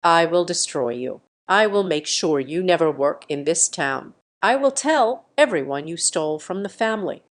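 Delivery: ordinary delivery, nothing marked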